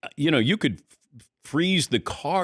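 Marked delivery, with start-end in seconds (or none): none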